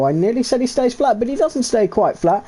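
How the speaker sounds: ordinary talk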